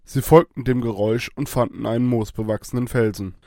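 plain reading voice

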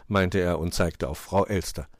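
ordinary delivery

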